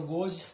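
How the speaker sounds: गोज said softly with a z ending. Shown as a demonstration. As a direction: say गोज, not gos. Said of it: "जी O E S".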